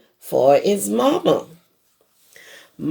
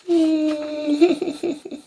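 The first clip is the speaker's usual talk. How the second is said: crying sob; 10 semitones higher than usual. Acted. Baby llama starts to cry.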